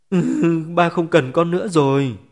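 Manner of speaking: put-on high, loud child's sob; crying little girl, harder. A narrator's reading. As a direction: bawl it out like a small child. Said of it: "cô bé lại càng khóc dữ dội hơn".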